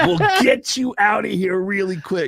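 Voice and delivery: put-on high, slow, drawn-out creepy old man; creepy old guy. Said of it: "and the creepy old guy".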